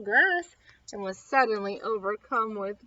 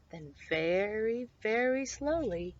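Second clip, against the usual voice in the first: usual speaking voice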